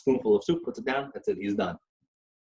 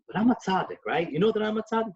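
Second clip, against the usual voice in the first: higher, playful mock pride; boastful Rebbe; 10 semitones higher than usual. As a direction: tease with a grand air. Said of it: "He goes".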